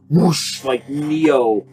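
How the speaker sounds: ordinary talk, nothing marked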